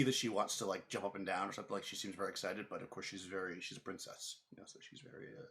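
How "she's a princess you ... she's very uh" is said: usual speaking voice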